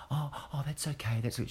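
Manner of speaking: high, gentle backpedalling "oh, oh, that's okay"; a hint of a flustered manager; mock apologetic lady. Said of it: "she reacts to the news".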